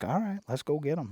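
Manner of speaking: high-pitched, game reply; retold eager agreement. Hunter agreeing to brother's plan, retold eagerness. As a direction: agree brightly, reenacting the moment.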